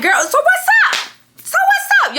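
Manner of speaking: very high, stretched comeback; mock challenge. Answering the friend who wants to fight, mock challenge. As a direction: throw the challenge back, voice way up.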